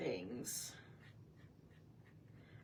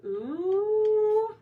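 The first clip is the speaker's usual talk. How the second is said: high, loud delighted ooh; 11 semitones higher, 9 dB louder than usual. Real, not acted. Pleased with how the colours look together, a high loud ooh. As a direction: swoon over the colours.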